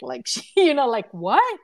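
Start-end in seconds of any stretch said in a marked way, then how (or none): 1.1-1.6 shocked what. Retold or imitated. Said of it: "she looked at me shocked".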